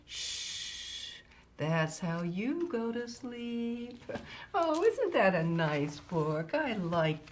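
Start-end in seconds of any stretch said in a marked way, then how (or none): 0.1-1.1 long, whispery shushing; soft hush. Acted. Dad hushing the little boy to sleep, soft shush. 1.6-4.0 drawn-out, lulling last line; slow bedtime ending. Acted. Reader ending the book after hushing the boy, slow lullaby delivery.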